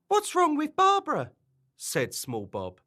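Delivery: very high-pitched puzzled question; tiny storybook voice, pitched right up; small character's voice. Acted. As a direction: squeak it like a worried little friend.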